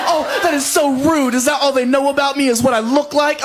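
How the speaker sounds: high-pitched mock indignation; offended. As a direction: imitate an offended girlfriend protesting. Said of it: "She was so offended".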